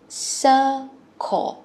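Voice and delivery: "circle" said slowly in two clear syllables, falling; teacher models "circle" for young pupils to repeat; model word for class. Shown as a demonstration. Teacher shows kids how to say "circle". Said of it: "Hai âm ha các con".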